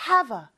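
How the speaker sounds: "have" run into the a, said hava; linked have a. Shown as a demonstration. Teacher showing how have a blends, hava. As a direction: glide "have" straight into the vowel, hava. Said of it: "요렇게 발음이 되기 때문에".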